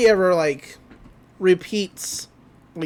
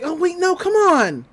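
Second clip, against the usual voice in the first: dismayed plea; 11 semitones higher than usual. Retold or imitated. Dipper calling as agents drive away, acted dismayed plea. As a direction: plead after them in a high voice.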